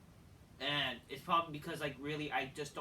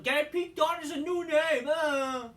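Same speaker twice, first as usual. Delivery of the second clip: high, loud mock enthusiasm; hammed-up high-pitched jeer; sarcastic mockery; 10 semitones higher, 8 dB louder than usual. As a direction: shout it as an over-excited fan would.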